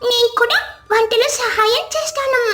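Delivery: put-on high small child's voice; a hungry little kid begging mom; whining little child. Hungry little child begging his mother, whiny.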